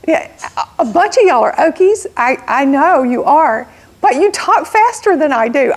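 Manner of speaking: ordinary talk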